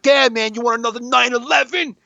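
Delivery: high mocking yell at dumb voters. Comic mimicking a scared voter, high mocking voice.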